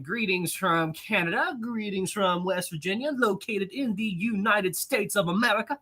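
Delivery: lightly put-on formal announcer voice; odd put-on voice. Streamer reads a viewer's greeting in a mild mock announcer voice. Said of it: "Why am I talking like that?".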